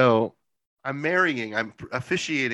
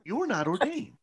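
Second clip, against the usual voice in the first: hammed-up sugary reproach; mock pastor accusation; 13 semitones higher than usual. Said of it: "that's how she opened".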